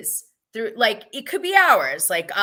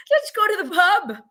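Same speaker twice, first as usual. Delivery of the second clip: put-on Irish accent; 10 semitones higher, 5 dB louder than usual. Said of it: "That's my Irish accent".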